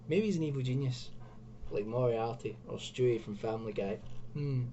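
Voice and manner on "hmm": normal talking voice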